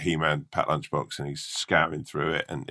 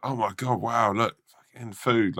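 higher-pitched, wowed crowd of rats; acted excitement; 6 semitones higher than usual. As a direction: play rats thrilled at a feast. Excited rats see the food.

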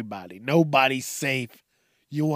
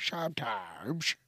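a slow, softer "Sometimes." redoing the crack; repeating the crack; 3 semitones lower, 10 dB quieter, slower than usual. Shown as a demonstration. He replays his own voice crack for listeners, slow and soft. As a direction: slowly redo "Sometimes." with the cracked sound. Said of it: "Did you hear that?".

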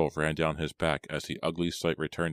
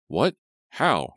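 louder, higher, urgent questions; demanding; 6 semitones higher, 7 dB louder than usual. A narrator's reading. Simon pressing Aggie for an answer, demanding. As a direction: fire off alarmed demands. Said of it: "he demanded".

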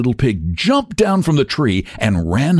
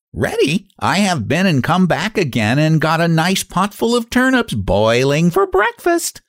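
high smug piglet voice; smug little pig; 7 semitones higher than usual. A narrator's reading. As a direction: gloat sweetly from safety. Pig mocks the wolf.

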